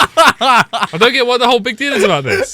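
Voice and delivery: high, loud, through laughter; laughing. Real, not acted. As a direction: giggle the words out. Laughing after the performance.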